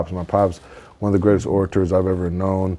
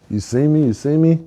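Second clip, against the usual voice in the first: higher, rising needy call; attention-seeking show-off; 7 semitones higher than usual. Acted. Show-off at the top calling for eyes, rising high voice. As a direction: mimic someone begging to be noticed.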